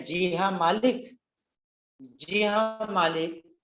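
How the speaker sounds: slow faltering "जी हाँ मालिक" in a strained voice; stammering. Acted. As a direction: stumble slowly over the words. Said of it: "तुतलाने लगता है".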